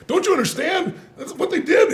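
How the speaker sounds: high-pitched retold outrage; worked-up, insistent imitation; intense, furious partner. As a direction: plead the case in mock fury. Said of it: "he was so intense".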